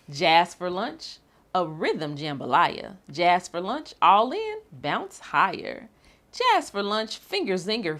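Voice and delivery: bouncy, sing-song storybook reading; rhythmic read-aloud. Acted. Librarian reading a jazz picture book to children, bouncy and rhythmic. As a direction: read it like a jazzy chant for kids.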